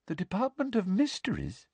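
soft, high, dreamy question; light, high-pitched puzzlement; mildly surprised. A narrator's reading. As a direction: wonder aloud, faintly surprised. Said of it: "looking mildly surprised".

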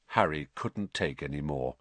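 usual speaking voice

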